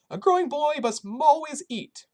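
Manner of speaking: put-on high, loud old Polish woman's accent; kindly old woman with a thick Polish accent. A narrator's reading. Shelter cook greeting the boy in a thick Polish accent. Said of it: "in her thick Polish accent".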